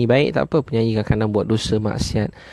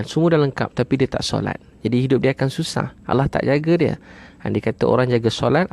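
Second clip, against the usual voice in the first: usual speaking voice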